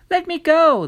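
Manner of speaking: put-on high, loud, desperate child's cry; shrill child begging to be released; boy crying out. A narrator's reading. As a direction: plead loudly to be let go like a caught boy. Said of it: "the boy cried".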